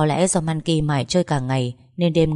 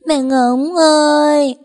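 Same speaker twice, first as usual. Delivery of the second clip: much higher, louder, slow drawn-out wail; wailing for mom; 11 semitones higher, 7 dB louder, much slower than usual. A narrator's reading. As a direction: cry out long for mommy. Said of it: "Khóc đến khàn cổ họng". (bé gào khóc gọi mẹ, cao, to và kéo dài)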